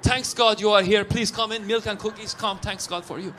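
put-on welcoming Persian-accented father; Persian dad. Dad greeting guests at his house, Persian accent. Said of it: "if you come over to my dad's house".

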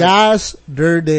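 very slow "Yesterday" with an open "yas"; slow "yasderday". Shown as a demonstration. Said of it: "Y A S S D E R D A".

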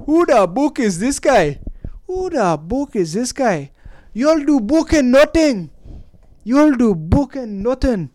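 high, exaggerated Irish fighter impression; Irish accent. Podcaster hamming up a fighter from a documentary, mock Irish accent. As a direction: put on a drawn-out, badly faked Irish accent. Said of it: "That's my Irish accent".

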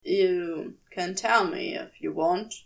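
ordinary delivery, nothing marked

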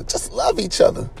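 breathy, choked-up plea; emotional. Real, not acted. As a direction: plead softly with the voice giving way. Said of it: "I'm getting a little emotional".

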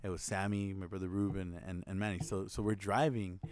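ordinary delivery

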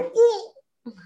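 high, sharp "uh" of recoil; disgusted yelp. Retold or imitated. Husband imitates wife recoiling from his kiss, disgusted yelp. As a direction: yelp high as if hit by a stench. Recoils from bad breath in story.

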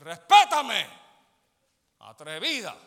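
loud commanding yell; demo shout. Shown as a demonstration. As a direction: bark it out to set her straight. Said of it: "tú tienes una boca para gritarle y decir". (grito fuerte de ejemplo, exigiendo respeto)

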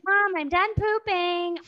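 high, slow singsong holler; stretched-out little-kid call; child calling for mom. Retold or imitated. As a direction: mimic a kid calling from another room. Imitates daughter calling out on call.

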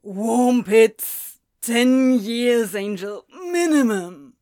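loud, slow despairing declaration; loud drunken wail. Acted. Drunk demon loudly declaring his punishment to the angel. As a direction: hammer each word home.

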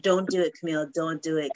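low, hushed self-warning; soft, low mock warning to oneself; calm self-restraint. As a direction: talk yourself down quietly. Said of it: "I'm actually keeping calm too".

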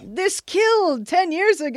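high exasperated voice; dismayed exec. Retold or imitated. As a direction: whine it out.

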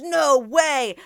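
high, loud startled exclamation; a kid surprised to see his teacher; weird surprise. Retold or imitated. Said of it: "Like, in a weird way".

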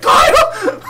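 very high, loud mock scream; retold scream. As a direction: scream the name, half laughing. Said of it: "I'm screaming".

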